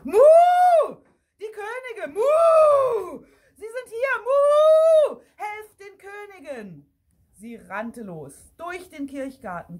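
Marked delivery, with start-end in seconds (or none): 0.0-6.9 very loud, high, long-drawn moos; frantic high-pitched mooing cow; screaming cow. A narrator's reading. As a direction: moo the alarm and cry for help. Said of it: "schrie Isabella".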